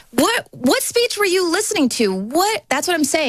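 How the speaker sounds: can't believe what they're hearing; incredulous disbelief. Real, not acted. Disbelief at media coverage.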